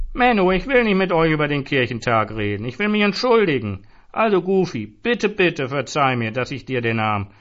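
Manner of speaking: high, loud begging plea; drunken wheedling plea, pitched high; pleading apology. A narrator's reading. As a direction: beg forgiveness with rising whine.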